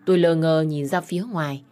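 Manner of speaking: plain reading voice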